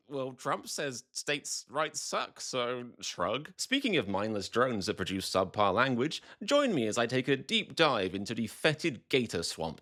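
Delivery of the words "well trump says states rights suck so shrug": soft, slow, deflated mock resignation; shrugging indifference. Republicans caving to Trump, limp indifferent shrug. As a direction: give up with a verbal shrug. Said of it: "to being like".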